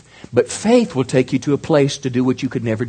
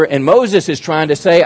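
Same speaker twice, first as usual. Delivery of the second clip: ordinary talk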